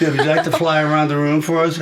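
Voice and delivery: fast, high, loud taunting teacher; mocking teacher. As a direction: sneer it like a cruel teacher. Teacher mocks Superboy costume.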